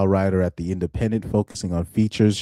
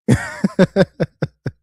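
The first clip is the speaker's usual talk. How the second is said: high giggle; 9 semitones higher than usual. Real, not acted. Amused by article names.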